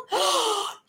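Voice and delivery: sharp high cry of surprise; boy gasping as he opens his notebook; startled high gasp. Acted. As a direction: gasp as if caught off guard. Boy opens notebook, sees blot.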